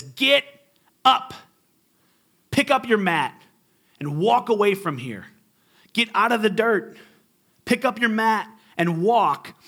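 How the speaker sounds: a preacher's forceful Jesus; firm command. Retold or imitated. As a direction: order him to his feet.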